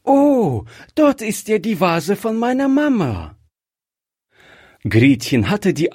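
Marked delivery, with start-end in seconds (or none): 0.0-3.4 put-on high, loud, delighted little girl's cry; child's excited exclamation, much higher; cried out loud. A narrator's reading. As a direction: call out in sudden joy at the vase. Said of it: "Plötzlich rief das Kind laut".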